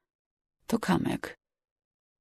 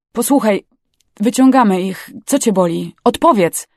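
loud, raised rescuer's voice; urgent insistence; 3 semitones higher, 6 dB louder than usual. A narrator's reading. Rescuer urges injured girl to respond, urgent. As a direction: demand an answer, voice up.

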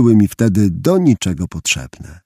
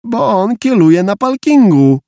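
put-on high, louder whiny little-boy voice; child justifying himself; 10 semitones higher, 4 dB louder than usual. A narrator's reading. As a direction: protest to mum like an indignant kid, r as l. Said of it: "wyjaśniłem". (wysoki głos tłumaczącego się dziecka)

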